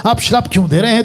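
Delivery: ordinary delivery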